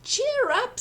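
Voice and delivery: high bright encouragement; cheery comfort. A narrator's reading. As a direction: lift them up with a high cheer. Giant comforting the mouse.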